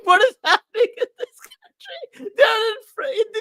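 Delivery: laughing disbelief. Retold or imitated. Laughing while retelling reaction.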